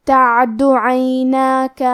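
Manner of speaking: تعد عیناک with a clear throat ع and long alif in ناک; slow tajweed of عیناک; clear ain. Shown as a demonstration. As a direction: slowly recite تعد عیناک, stretching نا. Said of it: "ہمیں مد الف کو ظاہر کرنا ہے".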